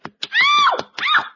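sharp high yelps of pain. Acted. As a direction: yelp as if spanked. Paddled, yelping in pain.

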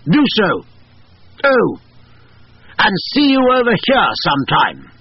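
a spirit colonel speaking through a woman; masculine medium voice. A narrator's reading. Colonel signs off via medium.

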